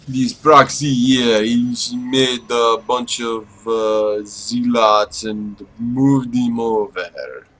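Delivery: slow, drawn-out put-on foreign accent; hammed-up accent, drawled slowly. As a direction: stretch every word in a mock accent. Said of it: "that accent there".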